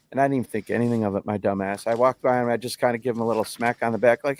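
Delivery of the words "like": ordinary talk, nothing marked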